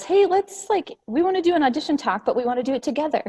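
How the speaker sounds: bubbly friends pitching an idea together; excited pitch idea. Retold or imitated. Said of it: "which was".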